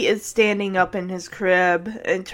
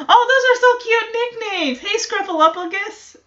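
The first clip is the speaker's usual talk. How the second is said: laughing delight; 10 semitones higher than usual. Real, not acted. Gushing over pet nicknames.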